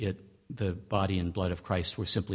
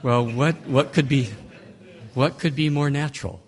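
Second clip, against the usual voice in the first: higher and louder, ironic disbelief; sarcastic mock wonder; 5 semitones higher, 5 dB louder than usual. Lecturer mocking a literalist reading to students, sarcastic. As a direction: sell the obvious with heavy irony.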